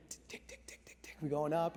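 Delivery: hushed whisper of the clicking climb; whispered suspense. Retold or imitated. Dad retells the coaster climb in a tense whisper. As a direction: whisper the ticks like a held breath.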